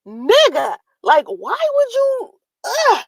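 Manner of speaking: high, stretched disbelief; why would you, exasperated; incredulous. Retold or imitated. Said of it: "And I'm thinking".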